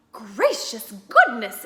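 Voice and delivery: slow, loud astonished exclamation; exclaimed. Acted. Wife stunned by the radio greeting, astonished. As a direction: gasp it out in amazement.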